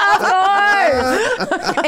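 high, loud outburst through laughter; laughing shout. Real, not acted. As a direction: shout it out, cracking up. Laughing outburst at obvious question.

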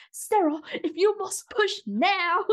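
high, drawn-out mock British nurse; bad British accent. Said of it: "I can't do a British accent".

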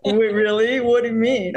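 can't take it in; amazed disbelief. Retold or imitated. Actress recalling the nomination call, sounding amazed.

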